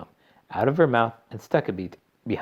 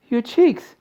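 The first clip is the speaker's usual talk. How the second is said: high, loud, horrified mother; scream; 8 dB louder than usual. A narrator's reading. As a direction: shriek in alarm. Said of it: "screamed Missus Beauregard".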